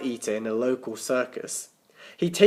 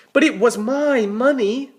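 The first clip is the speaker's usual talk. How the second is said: whiny Tim protest; 9 semitones higher, 6 dB louder than usual. Acted. Tim argues with Nick.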